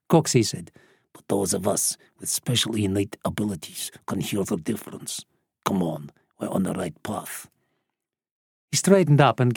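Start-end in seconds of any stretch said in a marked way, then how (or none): 1.1-7.5 put-on low, soft, knowing old man; old smuggler. A narrator's reading. Old smuggler correcting Lisa about the bird, low and quiet. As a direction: lower it and play the expert. Smuggler boasting of innate abilities.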